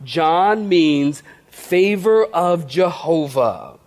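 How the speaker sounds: very slow, word by word, for note taking; dictating slowly. Shown as a demonstration. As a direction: spell it out so they can write it down. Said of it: "Write this down".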